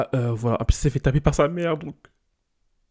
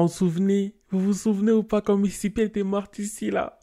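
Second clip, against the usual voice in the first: excited; 8 semitones higher than usual. Real, not acted. Asking viewers to remember.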